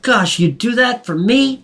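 high, gullible nerd voice; dopey Seymour. Retold or imitated. Seymour naively grateful to Mushkin, high dopey voice. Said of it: "Rick's like".

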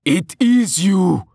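high, loud, drawn-out exclamation; loud, stretched cry of recognition; blurted astonishment. A narrator's reading. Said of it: "Anton blurted".

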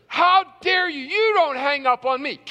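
re-enacted angry outburst, loud and high; retold indignant yell; furious indignation. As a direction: shout it at whoever hung up. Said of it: "I was so mad".

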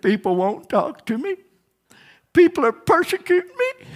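high, wailing complaint of a self-pitying man; mock his whining; whiny self-pity. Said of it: "he's just crying".

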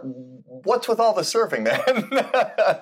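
laughing as he asks. Real, not acted. Teasing question about surfing.

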